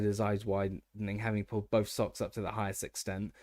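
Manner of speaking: plain reading voice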